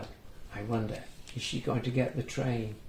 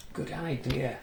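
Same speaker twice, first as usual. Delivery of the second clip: ordinary delivery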